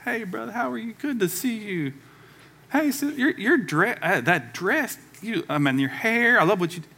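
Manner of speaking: high, overly nice fake greeting voice; preacher mimicking a churchgoer's fake-friendly hello; fake church greeting. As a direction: put on a sweet Sunday-morning mask. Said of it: "and you put on the face".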